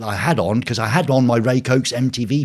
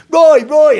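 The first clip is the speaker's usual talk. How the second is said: high, loud calling out across a crowd; shouting a name; 9 dB louder than usual. Retold or imitated. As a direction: yell Roy to grab the photographers. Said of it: "So they all go".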